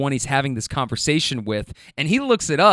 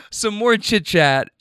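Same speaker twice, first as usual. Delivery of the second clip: normal talking voice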